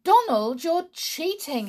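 put-on a mother warning her boy off cheating; mother's voice. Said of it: "his mother would say".

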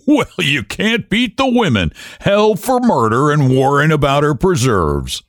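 a hint of a scoffing man; mocking husband. A narrator's reading. Husband laughs at women.

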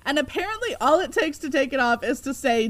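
ordinary talk, nothing marked

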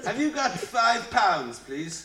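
high, slow, hammed-up English accent. Shown as a demonstration. Singer performing for the lads on request, exaggerated English accent. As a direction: stretch it out in a mock English accent. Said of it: "do an English accent".

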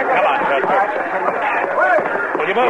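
high, urgent hurry-up shout. Acted. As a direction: snap at him to hurry. Marshal urging Chester after shot.

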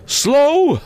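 very high, loud spectral echo of the word; indignant ghost. A narrator's reading. Ghost repeating Scrooge's word at him, high and indignant. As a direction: throw it back high, as an affronted spirit. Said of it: "the ghost repeated".

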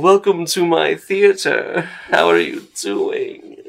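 slow, grand theatrical welcome; pompous actor. Acted. Actor welcoming guests to the theater, pompously. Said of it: "he's so pompous looking".

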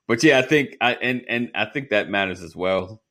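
ordinary delivery

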